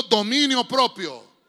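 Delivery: loud, slow congregational prompt; call for repetition. Real, not acted. Preacher asking the congregation to repeat, loud and slow. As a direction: ring it out slowly and forcefully. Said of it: "Diga conmigo".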